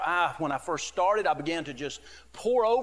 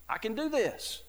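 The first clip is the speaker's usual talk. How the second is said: higher, louder cocky self-assurance; mock proud boasting; 9 semitones higher, 4 dB louder than usual. Preacher voicing the proud man's boast to the Lord, mock cocky. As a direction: puff up like a man who needs no help.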